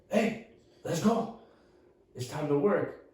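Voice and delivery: high, slow, drawn-out summons; eerie wake-up call. Retold or imitated. Crouched stranger waking dad for work, eerie calling voice.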